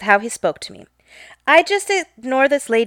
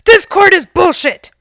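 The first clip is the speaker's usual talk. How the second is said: put-on much higher and louder, a cranky old man's courtroom outburst; angry old man outburst; 8 semitones higher, 7 dB louder than usual. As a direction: explode at the judge like a furious old man.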